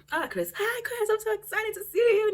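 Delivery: high, loud squealing hello; welcoming her best friend with a high squeal; excited greeting. Retold or imitated.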